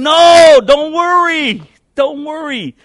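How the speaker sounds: high, loud insisting "don't worry"; urgent shout. Real, not acted. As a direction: drive it home with a shout. Preacher urging congregation.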